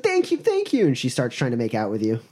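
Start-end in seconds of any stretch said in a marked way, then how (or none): 0.0-0.9 put-on very high, gushing girlish voice; grateful rescued girl. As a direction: gush in a high damsel voice.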